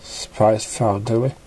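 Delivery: plain reading voice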